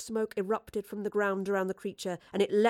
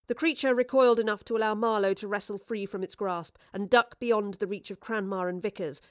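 usual speaking voice

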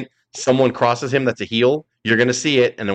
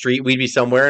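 normal talking voice

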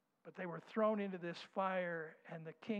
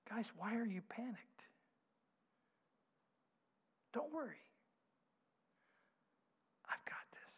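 calm reassurance; 4 semitones higher, 5 dB quieter than usual. Acted. Jesus calming the disciples.

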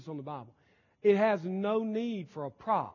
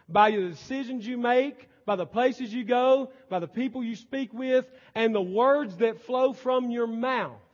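louder and higher, each phrase driven home; full-force preaching; 5 semitones higher, 6 dB louder than usual. Acted. Pastor urging his flock on, full-force preaching. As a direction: preach it hard to the congregation.

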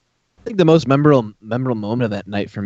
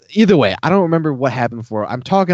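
ordinary talk, nothing marked

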